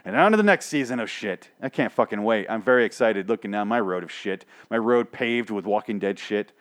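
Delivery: ordinary talk, nothing marked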